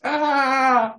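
high yelp of fake fright; mock scream. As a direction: yelp as if terrified.

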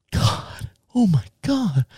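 whispered "God, oh my God"; horrified whisper. Retold or imitated. Young mom staring at the driver, horrified whisper. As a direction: breathe it out in horror. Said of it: "she's looking at me like this".

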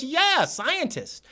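high, slow, drawn-out answer; imitated old scientist. Acting out Rich's proud answer.

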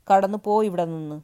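put-on woman ordering the beggar boy out, furious. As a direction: throw him out of the house.

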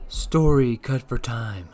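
deep slowed cut-in. Acted. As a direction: drop into a deep, slow voice to cut the story. Host cuts guest's story short.

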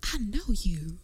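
breathless whispered I know you; starstruck whisper. Retold or imitated. Cohost imitating host meeting Michelle Hope, whispered. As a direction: whisper it in awe. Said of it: "You were like".

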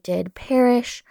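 ordinary talk, nothing marked

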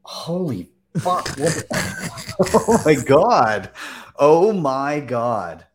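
loud, high, drawn-out disbelief; real shock. Real, not acted. Host reacts to miner's collapse, loudly stunned. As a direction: let the shock burst out loud and slow.

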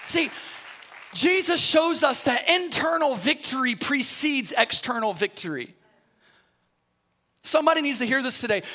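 loud, high, driving sermon climb; full-force preaching. Acted. Pastor preaching to the congregation about God's reality, loud and driving. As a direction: preach it hard to the crowd, building.

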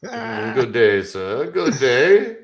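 posh colonial gentleman, laughing; hammed-up old-timey English; Old English accent. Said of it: "with that accent".

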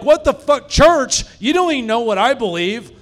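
high, loud indignant disbelief; outraged protest. Acted. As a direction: act out a kid outraged at being dragged along. Kid outraged at church.

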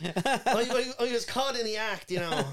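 hammed-up sheepish excuse; mock fighter. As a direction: fighter apologising to his wife, mock sheepish. Voicing fighter caught cheating.

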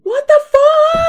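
high, loud, slow disbelieving cry; shocked disbelief. Real, not acted. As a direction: drag it out in shock. Shock at parents dead.

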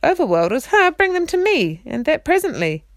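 loud, high imperious order; a haughty prince calling down; commanding call. A narrator's reading. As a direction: bark the order from above.

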